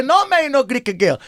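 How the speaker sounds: put-on high-pitched refusal in thick immigrant accent; Giuseppina, the mamma. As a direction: lay down the law as an old-country mamma, pitched high. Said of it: "That's Giuseppina". (mamma immigrata che vieta, voce acuta)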